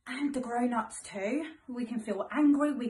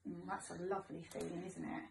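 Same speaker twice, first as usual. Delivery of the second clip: soft aside; 5 semitones lower, 12 dB quieter than usual. Real, not acted. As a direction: drop to a hush for the little ones.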